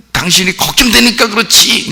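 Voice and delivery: high-pitched acted wail; worried wife's outcry. Wife explaining why she hits him, high mock-upset cry. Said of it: "이제 이거는 이제 조금 더 이제".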